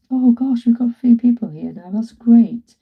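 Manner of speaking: excited. Real, not acted. Sees viewers arriving on live.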